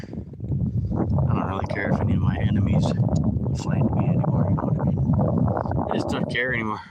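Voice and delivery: talking with the phone in his teeth; words muffled by the phone in his teeth; phone held in teeth. Real, not acted. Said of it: "I held the phone in my teeth as I paddled".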